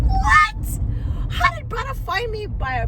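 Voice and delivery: stunned that he found her; shocked disbelief. Real, not acted. Telling viewers the man messaged her, shocked high cry.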